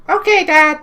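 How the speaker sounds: put-on squeaky kid answering his dad. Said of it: "I tried a kid voice".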